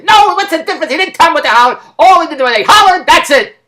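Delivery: high, loud interruption; exasperated retort. Retold or imitated. Daughter cutting off a guess at the words, exasperated. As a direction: slam the door on the question, exasperated. Friend cuts off his questions.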